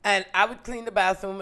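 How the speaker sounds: ordinary delivery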